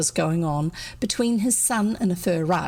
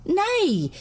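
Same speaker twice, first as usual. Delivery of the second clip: high stage cry; boy defying the villain; mock yell; 11 semitones higher than usual. A narrator's reading. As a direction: fling it out. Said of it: "yelled his son".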